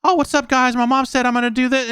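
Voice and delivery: put-on very high childish voice; voice-changed kid. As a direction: chirp it like a little kid on a voice changer. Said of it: "voice changer to sound like a kid".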